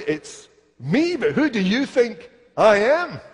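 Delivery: playful teasing challenge, rising high. Retold or imitated. Preacher teases the old neighbour, playfully rising. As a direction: toy with them, pretending not to be known. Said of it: "And I'll say".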